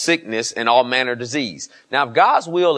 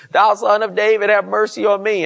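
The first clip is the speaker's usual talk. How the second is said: raised, pleading call; crying out for mercy; 7 semitones higher, 4 dB louder than usual. Retold or imitated. Blind Bartimaeus calling out to Jesus, pleading loudly. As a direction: beg the master loudly from the crowd. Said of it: "he cried even more the louder".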